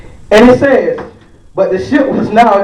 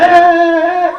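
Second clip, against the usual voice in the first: excited cheering; 8 semitones higher than usual. Retold or imitated. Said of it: "y'all be like".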